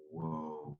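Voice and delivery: soft, low, drawn back; hushed reaction of being moved; quietly stunned. Retold or imitated. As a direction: let it out softly, taken aback. Recalls being moved by player's words.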